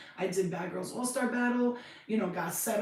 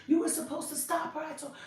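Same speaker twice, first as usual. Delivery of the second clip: mock angry boss yelling; boss screaming; 9 semitones higher than usual. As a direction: yell at the assistant. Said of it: "he started screaming on me".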